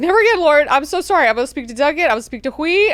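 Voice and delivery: high, loud mock panic; hammed-up frightened servant; frantic grovelling. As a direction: scramble to apologise and promise anything. Terrified servant grovels to his lord.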